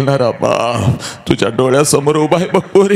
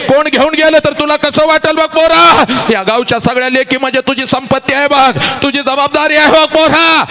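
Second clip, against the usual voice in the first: fervent preaching; 9 semitones higher than usual. Acted. Preaching to the boys.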